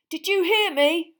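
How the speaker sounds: high, loud demand; insistent, strained question. A narrator's reading. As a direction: raise the voice to make him listen. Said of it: "Ron said weakly".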